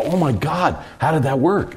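high-pitched, amazed exclamation; mock-astonished, pitched well up; retold amazement. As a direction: act out colleagues astonished it worked. Retold amazement after the edit works.

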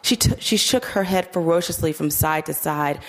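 plain reading voice

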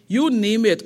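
ordinary talk, nothing marked

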